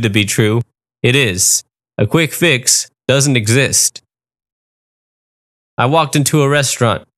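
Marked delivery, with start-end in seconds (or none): none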